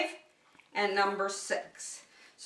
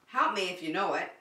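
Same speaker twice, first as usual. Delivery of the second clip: ordinary talk, nothing marked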